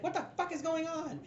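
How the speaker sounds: high-pitched put-on girl voice; brothel girl. Lady questions the brothel commotion, high put-on voice. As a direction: demand it in a high girlish pitch. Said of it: "one of the brothel girls".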